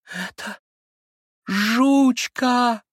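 high, drawn-out cry of suffering and joy; cracked, overwrought voice. A narrator's reading. Sick boy recognizing his lost dog, high overwrought cry. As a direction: let the voice crack with pained happiness. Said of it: "прокричал он вдруг надтреснутым от страдания и счастья голоском".

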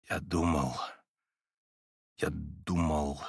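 low, soft, slow held-back words; quiet and restrained. A narrator's reading. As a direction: hold your anger down, speak quietly. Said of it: "как-то тихо и сдержанно проговорил он".

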